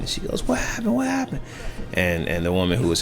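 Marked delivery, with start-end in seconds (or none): 0.5-1.4 imitating a frightened woman's high cry; panicked cry. Wife rushing toward her fallen husband, frantic high-pitched panic. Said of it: "woman screaming at the top".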